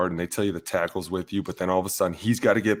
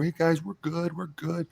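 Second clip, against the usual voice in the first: high, softer put-on voice; running back reassuring; 8 semitones higher, 4 dB quieter than usual. Running back checking in with the linemen, high mock reassurance. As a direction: mimic a teammate's unconvincing reassurance. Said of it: "the running backs come over".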